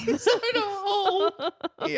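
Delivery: high, squeaky laughing speech; helpless laughter. Real, not acted. Host repeating a player's wrong guess, cracking up. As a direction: barely get it out for laughing. Laughing at the misheard puzzle phrase.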